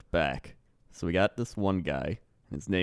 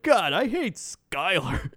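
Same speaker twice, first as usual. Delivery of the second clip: slow, high put-on gripe; mock exasperation; 8 semitones higher, slower than usual. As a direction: groan it out like a fed-up driver, hammed up. Driver's acted exasperated gripe.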